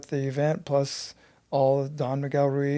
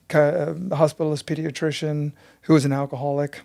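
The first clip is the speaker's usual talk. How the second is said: ordinary talk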